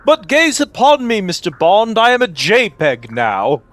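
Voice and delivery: high, loud grand villain speech; booming echoing villain monologue. Acted. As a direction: proclaim it like a gloating Bond villain. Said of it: "Bond villains do just sound like that".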